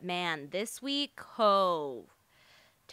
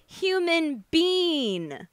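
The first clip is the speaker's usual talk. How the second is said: slow mock retainer slur, higher; wearing a retainer; 7 semitones higher, 4 dB louder, much slower than usual. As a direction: drag it out, mimicking the lisp. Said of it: "She was like".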